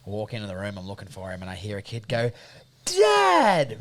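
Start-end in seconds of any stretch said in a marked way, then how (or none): 2.8-3.8 put-on high, loud delighted kid's call; imitated happy kid. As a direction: cry out like an overjoyed toddler. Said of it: "Real happy".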